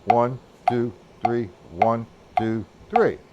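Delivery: low, evenly spaced numbers, each on the beat; subdivision counting. Shown as a demonstration. Drum teacher counting the triplet for subdivisional reasons, rhythmic counting. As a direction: count steadily in repeating threes. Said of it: "or for subdivisional reasons".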